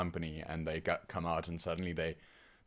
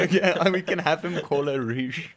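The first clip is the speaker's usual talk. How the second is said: talking through helpless laughter, high and loud; real laughing fit; 12 semitones higher, 10 dB louder than usual. Real, not acted. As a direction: squeal it out while cracking up. Cracking up over the misread name.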